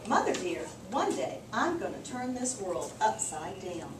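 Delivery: normal talking voice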